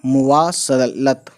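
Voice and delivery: मुवासलत drawn out slowly; slow careful pronunciation. Shown as a demonstration. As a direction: stretch मुवासलत out slowly, each syllable clear. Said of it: "तो ये word है मवासलत".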